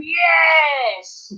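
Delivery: long, high mock shout; imitated crowd cheer. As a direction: imitate neighbours cheering a late goal. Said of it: "heard all them going".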